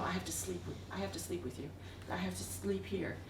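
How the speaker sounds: hushed and intimate; hushed longing. Retold or imitated. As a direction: say it quietly, as an inner certainty. Confiding what the soul felt.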